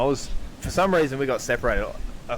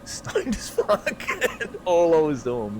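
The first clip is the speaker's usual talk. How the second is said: high wheezy laugh through words; helpless giggle; 7 semitones higher, slower than usual. Real, not acted. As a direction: squeak it out mid-giggle. Laughing while telling drunk story.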